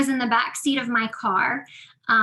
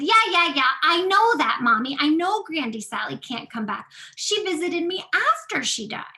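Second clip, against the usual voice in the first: put-on higher, louder child insisting; louder insistent child; 5 semitones higher, 5 dB louder than usual. Daughter in the back seat insisting to mommy, louder child voice. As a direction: play the kid brushing off mom.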